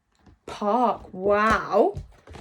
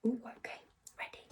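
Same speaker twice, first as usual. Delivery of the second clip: whisper; 16 dB quieter, much faster than usual. Shown as a demonstration. Teacher whispering to pupils.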